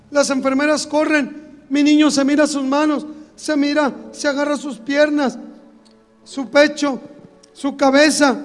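ordinary delivery

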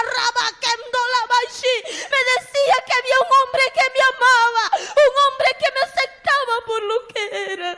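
voz mucho más aguda, casi llorando; testimonio ferviente. Real, not acted. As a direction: predica su testimonio con el alma. Testimonio con voz alta y llorosa. (cuenta a la iglesia su conversión, aguda y quebrada)

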